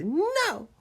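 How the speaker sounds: high, loud refusal; defiant kid. Retold or imitated. Boy answering his dad about quitting, defiant high shout. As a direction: cry it out like a determined little boy.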